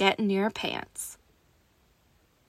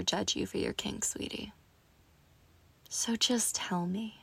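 whisper; 6 dB quieter than usual. Acted. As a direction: coax it out in a whisper. Seductive roleplay whisper.

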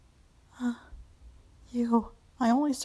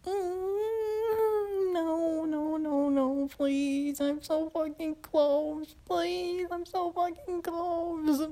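higher, slow, crying pleading; whimpering plea; 5 semitones higher, much slower than usual. Acted. Woman begging her master not to stop, high whimper. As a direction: whine it out, right on the edge.

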